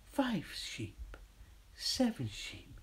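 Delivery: whispered sheep count; slow whispered counting; drowsy counting sheep. Pretend. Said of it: "the countdown for sheep".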